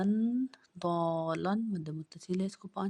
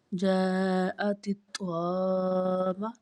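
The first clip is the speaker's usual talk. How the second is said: slow, a bit louder, stretched; slow blended reading; 5 dB louder, much slower than usual. Shown as a demonstration. Teacher joining the letters for a pupil, slow and clear. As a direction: draw the word out clearly. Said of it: "teacher blends spelled letters".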